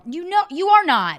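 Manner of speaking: loud, high protest; heated contradiction. Real, not acted.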